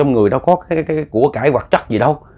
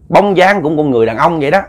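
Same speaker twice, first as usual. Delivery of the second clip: plain reading voice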